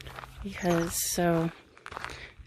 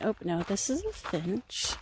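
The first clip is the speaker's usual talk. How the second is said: ordinary delivery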